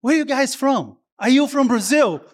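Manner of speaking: mock-eager wait wait wait; excited curiosity. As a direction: imitate Lori catching on excitedly. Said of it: "she's like".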